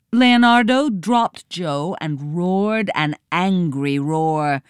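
ordinary delivery

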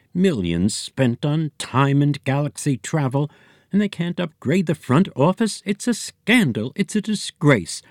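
rising to a high, outraged pitch; indignant rant. Acted. Receptionist ranting about the office.

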